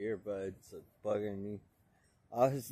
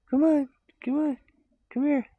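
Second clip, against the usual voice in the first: high, coaxing pet voice; 12 dB louder, faster than usual. Real, not acted. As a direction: coax the cat over sweetly. Coaxing the cat.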